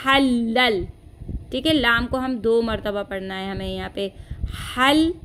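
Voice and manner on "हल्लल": हल्लल holding the double laam; doubled laam. Shown as a demonstration. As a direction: make both laams heard. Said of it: "लाम की तशदीद को जाहिर करेंगे".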